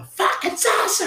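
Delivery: shrill-free high mock girlfriend voice; high squeaky girl voice. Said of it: "And then she's like".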